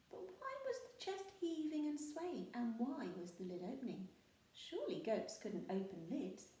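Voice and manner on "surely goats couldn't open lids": plain reading voice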